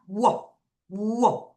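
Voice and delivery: "워" as a rounded w glide; W sound. Shown as a demonstration. Teacher showing students the W sound, "워" sounded alone. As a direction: isolate the W as "워", slowly and separately. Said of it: "W는 무슨 발음이에요?".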